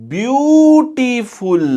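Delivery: ब्यूटीफुल sounded out high and slow, syllable by syllable; syllable-by-syllable demo. Shown as a demonstration. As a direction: stretch every sound of ब्यूटीफुल so it can be counted. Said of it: "अब sound सुनना".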